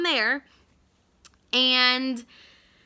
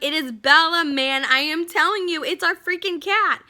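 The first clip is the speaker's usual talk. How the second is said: higher and louder, emphatic; insistent; 6 semitones higher, 6 dB louder than usual. Retold or imitated. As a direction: insist he's wrong about the cat. Insisting to doubting husband.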